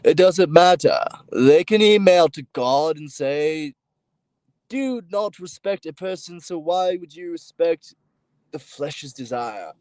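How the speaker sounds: slow proclamation, loud at the start; preaching declamation. Acted. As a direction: proclaim it like a preacher, drawing out the words. Declaims a quoted message to God.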